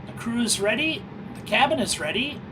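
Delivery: ordinary talk, nothing marked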